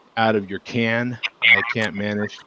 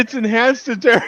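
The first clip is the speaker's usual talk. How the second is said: laughing; 12 semitones higher, 3 dB louder than usual. Real, not acted.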